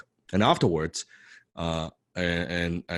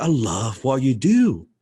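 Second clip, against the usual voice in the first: loud, higher, slow emphatic gushing; gushing excitement; 4 semitones higher, 7 dB louder, slower than usual. Retold or imitated. Excited fan praising his idol, loud emphatic gushing. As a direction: pour out exaggerated admiration. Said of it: "I was jumping up and down".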